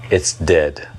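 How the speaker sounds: understated, matter-of-fact verdict. Retold or imitated. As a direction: quote a brief verdict matter-of-factly. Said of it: "he said very matter-of-factly".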